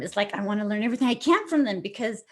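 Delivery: usual speaking voice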